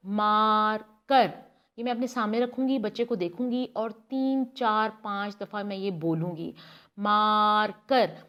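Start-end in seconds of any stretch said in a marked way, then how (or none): 0.1-1.3 slow demo word. Shown as a demonstration. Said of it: "इस तरह बोल के". 7.0-8.2 marker said very slowly, syllable by syllable, falling at the end; drawn-out syllables. Shown as a demonstration. Speech therapist to child, marker said very slowly in syllables. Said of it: "इस तरह बोल के".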